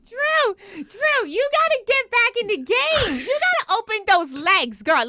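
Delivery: excited. Real, not acted. Hyping up friend.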